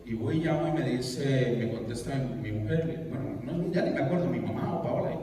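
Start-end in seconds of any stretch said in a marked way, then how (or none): none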